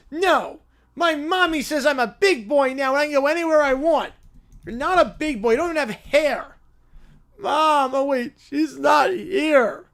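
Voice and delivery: put-on high, loud, bratty little boy; whiny little kid. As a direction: demand it like a spoiled preschooler.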